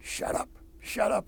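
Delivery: hushed, breathy whisper; urgent whisper. Retold or imitated. Fellow Marines hushing him at night, urgent whisper. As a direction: hiss it under your breath to silence him. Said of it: "the guys next to me were going like".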